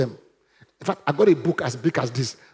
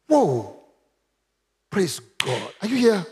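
ordinary delivery

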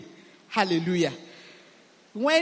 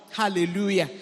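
ordinary delivery

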